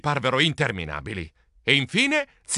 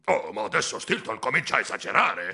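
lower-pitched grumble; murmuring relative; 5 semitones lower than usual. Acted. Relative fed up with Stilton, low murmur. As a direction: mutter disapproval in a low voice. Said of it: "Udì mormorare".

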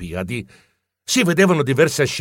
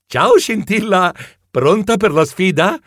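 cheery greeting; 5 semitones higher, 4 dB louder than usual. A narrator's reading. Greeting rival before the race.

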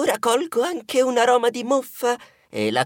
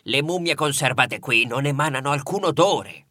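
museum director; 7 semitones lower than usual. Acted. Museum director reassuring the visitors about the smell, lightly lowered voice.

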